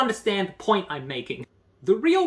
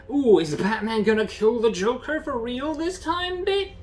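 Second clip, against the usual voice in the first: high, excited fanboy tease; hammed-up breathless fan voice; mock hype; 7 semitones higher than usual. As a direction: tease the big dramatic question. Mock hype voice for comic fans.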